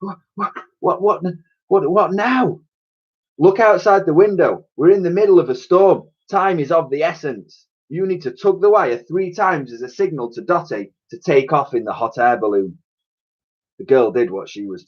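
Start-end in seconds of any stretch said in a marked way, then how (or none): 0.0-2.6 higher, breathless what-what-what; flustered stammer. Acted. Elsie stammers, worn out on the tank. 3.4-12.8 higher, demanding professor. Acted. Professor demands whether Elsie found the hatch.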